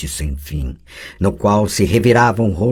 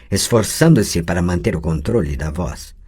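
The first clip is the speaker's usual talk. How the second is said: usual speaking voice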